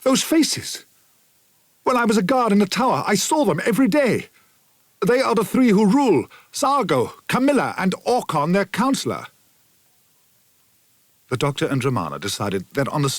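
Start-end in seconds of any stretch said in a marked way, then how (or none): 0.0-9.3 much higher, rushed, horror-struck recognition; cry of horror. A narrator's reading. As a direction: blurt it out in shock, voice climbing. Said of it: "he gave a cry of horror".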